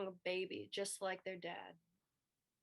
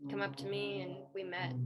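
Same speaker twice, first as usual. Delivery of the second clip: usual speaking voice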